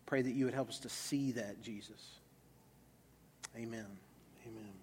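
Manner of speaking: soft, unhurried prayer; low, reverent praying voice. Real, not acted. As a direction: pray gently, trailing down to a quiet amen. Leads a closing prayer.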